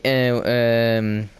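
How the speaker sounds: normal talking voice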